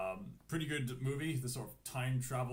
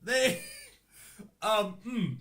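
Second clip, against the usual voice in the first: laughing; 6 semitones higher, 7 dB louder, slower than usual. Real, not acted. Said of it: "with a straight face".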